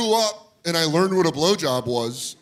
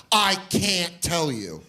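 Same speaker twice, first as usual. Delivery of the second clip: slow, stretched words, acting a stubborn kid; mock-dramatic slow refusal; drawn-out refusal; 3 semitones higher, much slower than usual. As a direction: draw out each word of the refusal. Same refusal, more drawn out.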